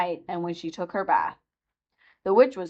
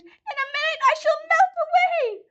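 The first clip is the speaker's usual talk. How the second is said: panicked witch. A narrator's reading. Melting witch wailing in panic, very high. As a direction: wail in panic.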